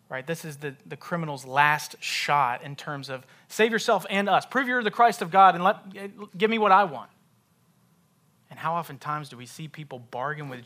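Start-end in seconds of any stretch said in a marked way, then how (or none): 3.5-7.1 loud, raised-pitch bargaining demand; demanding criminal. Acted. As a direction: challenge him, loud and high. Criminal's last-shot demand, acted.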